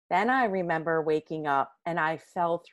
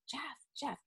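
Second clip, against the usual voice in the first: faint whispered calling; calling out with no voice left; 8 semitones higher, 15 dB quieter than usual. Retold or imitated. Woman faintly whispering for her husband at night. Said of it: "And I'm just like".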